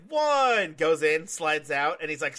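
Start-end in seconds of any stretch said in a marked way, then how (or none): 0.1-0.7 a pitched-up called-out count, loud; mock referee count. Imitating the ref calling the count, pitched up and louder. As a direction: call the count like a ref, a touch higher. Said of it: "He was like".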